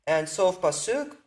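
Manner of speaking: ordinary talk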